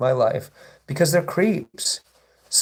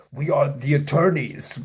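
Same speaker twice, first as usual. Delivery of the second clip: lightly put-on mock attorney voice. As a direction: imitate a troll posing as a lawyer. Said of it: "lawyers don't talk like that".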